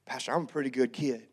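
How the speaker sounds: a hint of an East Texas kid shrugging it off; East Texas teen. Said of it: "That's how they all talked".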